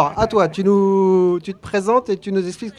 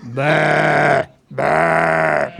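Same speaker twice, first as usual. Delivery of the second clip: put-on low bleat of an old ewe; old sheep bleat; 5 semitones lower than usual. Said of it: "une vieille brebis alors".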